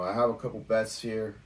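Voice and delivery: ordinary talk, nothing marked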